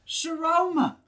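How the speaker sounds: loud, high scream to someone indoors; screamed name. Retold or imitated. Locked-out friends calling up to Sharona, screaming. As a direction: mimic friends yelling from the street. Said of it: "so they're screaming".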